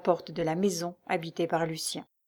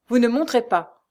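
loud, raised, forceful ban; brutal refusal; 5 semitones higher, 7 dB louder than usual. A narrator's reading. Concierge forbidding Lucie to go up, brutal. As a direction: block the way with a brusque order. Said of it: "Le ton brutal".

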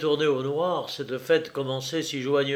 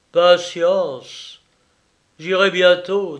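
louder, grim smiling menace; frightening smile; 5 semitones higher, 9 dB louder, slower than usual. Acted. Jacques answering Clémence, a grim smiling menace. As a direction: smile darkly as you promise. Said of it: "avec un effrayant sourire".

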